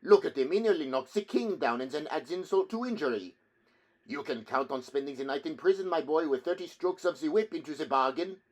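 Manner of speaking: high, loud, gloating taunt; jeering bystander. A narrator's reading. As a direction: taunt him loudly, relishing his trouble. Bystanders mocking the humbled Italian.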